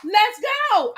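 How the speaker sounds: high, bouncy game-on voice; playful eager joining in. Pretend. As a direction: act keen to join the party. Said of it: "I probably would have done the same thing".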